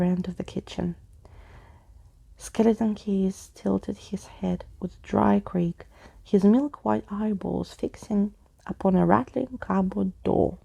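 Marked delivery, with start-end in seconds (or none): none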